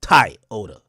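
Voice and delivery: Toyota as "Tie-ota", loud Jamaican first syllable; Jamaican accent. Shown as a demonstration. Demonstrating the Jamaican way, emphatic Jamaican accent. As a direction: punch the "tie" in Jamaican Toyota, falling after. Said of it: "That's Jamaican shit".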